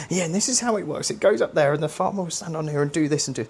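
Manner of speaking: high, quick gushing explanation; overexcited explaining. Retold or imitated. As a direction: sell the old machine to bored kids. Teacher explaining machine eagerly.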